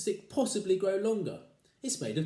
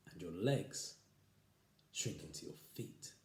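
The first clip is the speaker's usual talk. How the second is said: much softer, creeping menace; spooky hush; 5 semitones lower, 8 dB quieter than usual. Acted. Husband frightening his wife, soft and creeping. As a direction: hush it down like a ghost story.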